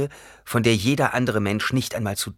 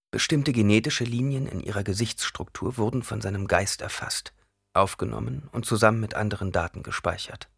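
ordinary delivery

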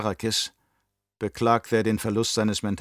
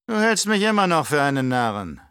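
put-on higher, louder, oily old Baron voice; scheming old Baron; 5 semitones higher, 4 dB louder than usual. Baron to his nephew, raised and sly. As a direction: play the cunning uncle sounding out his nephew.

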